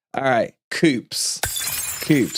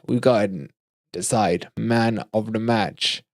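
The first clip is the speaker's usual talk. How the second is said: slower and softer, spacing out "man of the match"; slow, deliberate repeat; 4 semitones lower, 4 dB quieter than usual. Real, not acted. Host announcing the vote to viewers, slow and deliberate. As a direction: spell out "man of the match" slowly and quietly.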